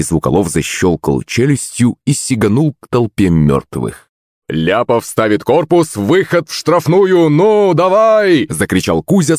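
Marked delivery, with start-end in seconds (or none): 4.5-8.5 rising, excited commentator yell; football commentator. Acted. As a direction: call the attack like live TV commentary. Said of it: "словно футбольный комментатор".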